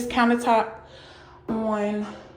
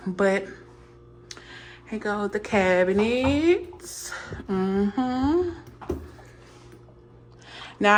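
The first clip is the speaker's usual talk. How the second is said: ordinary delivery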